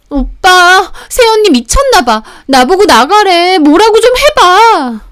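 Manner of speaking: very high, loud, stretched-out whine; whiny outraged appeal. Acted. Sister begging her brother to intervene, whiny outrage. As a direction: wail for backup, dragging out the endings.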